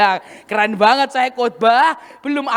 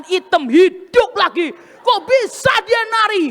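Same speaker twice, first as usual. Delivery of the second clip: put-on high, insistent complaint in a young woman's voice; aggrieved retold accusation, pitched high; accusing dancer; 5 semitones higher than usual. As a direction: voice the hurt girl scolding her pastor. Dancer accuses preacher of judging.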